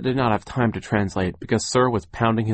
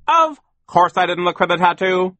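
loud, high, impatient retort; raised voice, indignant dismissal; 10 semitones higher, 7 dB louder than usual. A narrator's reading. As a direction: snap it back with exasperation. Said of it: "Sir said impatiently".

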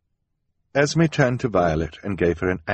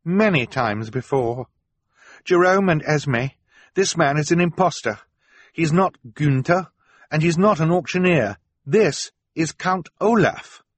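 courageous accusation; 10 semitones higher, 3 dB louder than usual. Acted. Violet exposes the impostor to her guardians, bold and insistent. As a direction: speak up firmly, pitched high and deliberate. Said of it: "finding the courage to speak up".

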